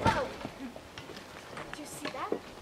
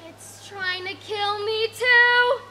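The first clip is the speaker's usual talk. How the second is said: higher, louder and slow in terror; terrified panic; 5 semitones higher, 7 dB louder, much slower than usual. Acted. Lion panics at thing overhead, slow terrified wail. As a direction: wail it in horror.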